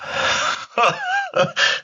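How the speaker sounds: high-pitched burst of laughter; amused laugh. Real, not acted. Storyteller tickled by his own hunting tale, real laugh. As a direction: crack up at your own story.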